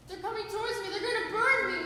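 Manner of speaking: frightened of burning; panicked fear. Acted. Fran panics at the flames.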